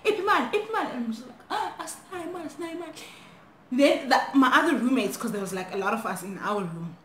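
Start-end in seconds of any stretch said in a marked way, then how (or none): 0.0-0.9 high-pitched, insistent mock demand; imitated demand. Intruders demanding money from girl, imitated insistence. 1.5-2.9 high, softer, fast whimpered plea; acted pleading. Frightened girl telling robbers there's no money, meek pleading.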